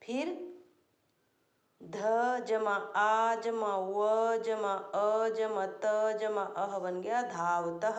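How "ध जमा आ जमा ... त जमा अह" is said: slow spelling out. Shown as a demonstration.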